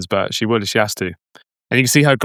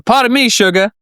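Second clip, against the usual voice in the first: Southern accent; 10 semitones higher, 3 dB louder than usual. Shown as a demonstration. Southern accent, showing how the card name must be said. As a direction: put on Rogue's Southern accent, pitched up. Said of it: "You gotta say it in that accent".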